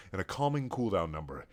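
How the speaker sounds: plain reading voice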